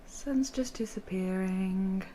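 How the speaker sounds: slow soft unvoiced whisper. Real, not acted. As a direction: hush for the sunset. Whispering at sunset.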